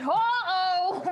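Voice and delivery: high, loud "uh-oh"; yelled alarm. Acted. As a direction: yell it out in playful alarm. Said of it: "start yelling".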